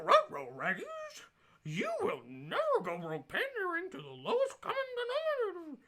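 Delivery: high, loud cartoon dog voice. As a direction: ham it up like a cartoon dog saying ruh-roh. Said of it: "I do professional accents on the side".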